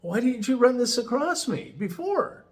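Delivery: a kindly reproach in a lifted voice; imitating Rick. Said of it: "he says".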